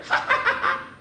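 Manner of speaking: high, breaking "ha-ha" laugh; bursting laughter. A narrator's reading. Bismarck giving in on the dare, bursting laughter.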